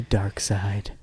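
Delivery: hushed ominous whisper; dramatic whisper. Acted. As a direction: breathe it like a spooky secret.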